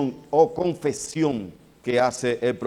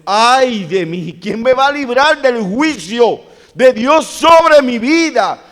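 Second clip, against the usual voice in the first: much higher and louder, a prophet's desperate wail; anguished cry; 9 semitones higher, 12 dB louder, slower than usual. Acted. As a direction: cry out as if judgment is upon you. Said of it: "él lo que estaba diciendo". (grito angustiado del profeta, agudo y fuerte)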